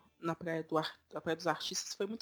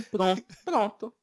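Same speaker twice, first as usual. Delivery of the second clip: loud, slow Northeastern "pronto"; imitated Northeastern accent; 3 semitones higher, 9 dB louder, slower than usual. Showing a friend the locals' catchword, Northeastern accent. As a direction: lightly mimic the locals' "pronto", drawing it out. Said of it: "o pronto deles".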